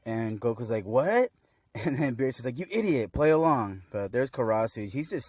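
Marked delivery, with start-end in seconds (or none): 0.8-1.3 high, loud baffled "what"; mock surprise. Goku acted as caught off guard. 2.6-3.8 loud, raised-pitch exasperated telling-off; play-acted irritation, higher and louder; mock scolding. Beerus scolds Goku, acted.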